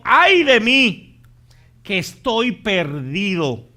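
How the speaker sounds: slow, loud anguished cry of Isaiah; Isaiah's cry. Retold or imitated. Preacher voicing Isaiah crying out before God, loud and slow. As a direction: cry out the prophet's lament, drawn out. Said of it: "él gritó y dijo".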